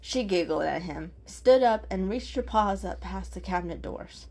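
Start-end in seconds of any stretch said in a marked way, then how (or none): none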